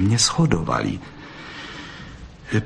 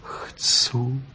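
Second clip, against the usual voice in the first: soft whispered yes; whispered meek consent; humble whisper; 3 semitones higher, 4 dB quieter than usual. A narrator's reading. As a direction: breathe the answer meekly. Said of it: "odvětila pokorně".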